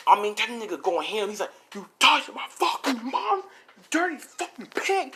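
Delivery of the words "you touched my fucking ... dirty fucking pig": strained high mock fury; acted rage. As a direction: voice an enraged son, pitched way up. Acted son raging at attacker.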